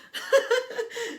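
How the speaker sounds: a loud, high burst of laughter; high laugh at her parents knowing already. Real, not acted. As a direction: crack up at the punchline. Laughing at mom's line.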